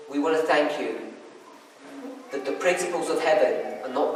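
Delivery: real, earnest spoken prayer. Real, not acted. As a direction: address God softly, with long reverent pauses. Leads a closing prayer.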